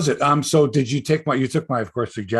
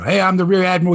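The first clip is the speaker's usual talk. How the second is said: put-on loud, higher-pitched Boston accent; 6 semitones higher, 7 dB louder, faster than usual. Co-host imitating a podcaster introducing himself, Boston accent. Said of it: "He's got a Boston accent".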